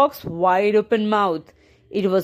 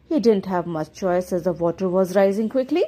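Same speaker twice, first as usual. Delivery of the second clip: ordinary delivery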